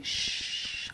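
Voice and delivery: soft unvoiced shh; hushing. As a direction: hush a classmate. Friends hushing.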